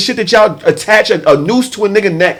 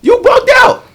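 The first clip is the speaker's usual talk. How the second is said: You bugged out jumps way higher and louder, playful disbelief; exaggerated high; 4 dB louder than usual. Teasing a close friend, you bugged out, high and loud. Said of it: "I can say".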